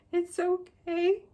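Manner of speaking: very high, drawn-out retold reassurance; sing-song polite reply, pitched right up. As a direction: stretch it out slowly in a high, loud voice.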